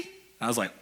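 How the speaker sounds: usual speaking voice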